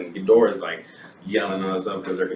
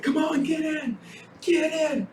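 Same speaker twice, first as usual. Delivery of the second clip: high, shouted urging to hurry; urgent shout; slower than usual. Retold or imitated. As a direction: yell at them to get in fast. Father urging people into elevator.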